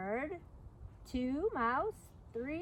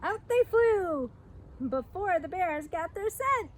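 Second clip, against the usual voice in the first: excited escape; 6 semitones higher, 5 dB louder, slower than usual. A narrator's reading. Animals escape bears.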